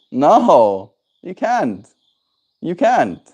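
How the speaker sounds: high, sing-song telling-off of the dog; firm no to dog. Real, not acted. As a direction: block the dog with a playful firm no.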